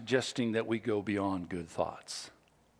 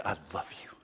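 hushed, unvoiced I love you; gentle whispered love; tender whisper; 8 semitones lower, 8 dB quieter, much faster than usual. Shown as a demonstration. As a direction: breathe it softly like a secret. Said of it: "find no reason at all to say".

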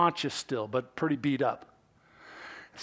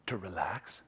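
low, near-whispered query; hushed question; 8 semitones lower, 10 dB quieter than usual. Shown as a demonstration. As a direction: ask it very softly. Gently questions listeners' instinct.

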